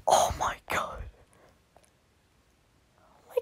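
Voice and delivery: gasp, then whisper it with no voice; hushed shock at the picture; whispered oh my god; breathless whisper. Real, not acted. Gasping at a drawing.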